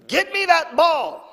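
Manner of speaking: high loud bratty demand; high, loud child's command; demanding kid. Retold or imitated. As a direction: yell it like a spoiled little boy. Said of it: "And I said".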